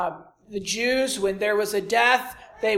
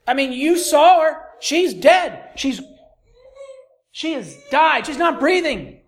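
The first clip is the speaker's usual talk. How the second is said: higher, louder incredulous protest; doubting mourner; 6 semitones higher, 5 dB louder than usual. Retold or imitated. A mourner protesting to Jesus, loud and disbelieving. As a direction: argue she's clearly gone. Preacher voicing doubting crowd.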